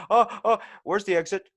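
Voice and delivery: loud, high mock panic; panicked cartoon character. Man fleeing the dogs, high hammed-up cartoon panic. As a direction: yelp for the way out.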